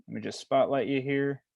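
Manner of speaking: ordinary delivery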